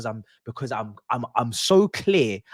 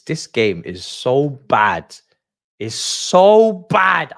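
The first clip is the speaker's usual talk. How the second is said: slow, loud, drawn-out disgust; exasperated; 8 dB louder, much slower than usual. Real, not acted. Streamer venting at a dull match, loud and drawn-out. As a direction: drag out the so in disgust.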